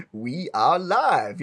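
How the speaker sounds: very slow, drawn-out show opener; Cog impression. Co-host shows how the intro should go, Cog impression. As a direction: imitate the host's intro, stretching each word. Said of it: "where he goes".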